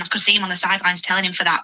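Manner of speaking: ordinary delivery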